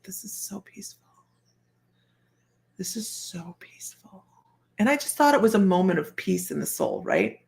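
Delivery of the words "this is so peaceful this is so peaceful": very soft, whispered wonder; peaceful, breath-light murmur to oneself; hushed awe. Retold or imitated. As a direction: let it out as a near-whisper. Hushed inner thought in a peaceful moment.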